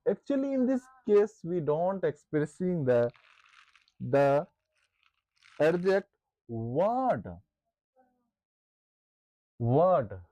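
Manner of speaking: slow, word-by-word dictation pace. Shown as a demonstration. Teacher dictating a definition to the class, slow and deliberate. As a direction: dictate each word slowly so students can write. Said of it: "teacher dictating definition for notes".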